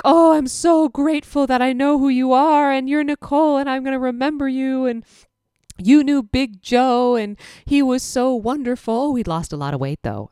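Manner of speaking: high, gushing thanks in a hug; tearful grateful owner. Retold or imitated. Hamster owner hugging the tech, high and gushing. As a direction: pour out gratitude, voice lifted. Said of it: "she's like".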